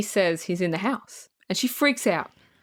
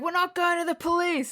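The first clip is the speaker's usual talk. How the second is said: higher-pitched quoted refusal; lightly acted, pitched-up objection; mock defiance; 9 semitones higher than usual. Woman in the film refusing the police, mock defiant.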